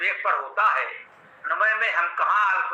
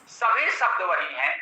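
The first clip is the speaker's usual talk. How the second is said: ordinary delivery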